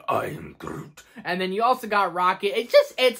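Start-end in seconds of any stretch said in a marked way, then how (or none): 0.1-1.0 Groot. Retold or imitated. Quoting Groot.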